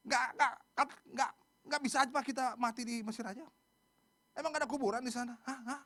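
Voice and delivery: high, agitated stutter into protest; hammed-up griping and challenging; angry complaining. Israelites at the sea complaining, acted angry protest. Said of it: "Marah, komplain".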